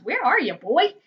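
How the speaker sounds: lightly acted fatherly shout. A narrator's reading. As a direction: call sternly for the boy, half-voiced. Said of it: "he shouts".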